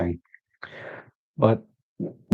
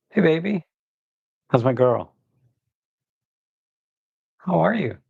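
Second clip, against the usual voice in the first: doting pet talk; 5 semitones higher than usual. Real, not acted. To his dog Ellie, high doting baby talk.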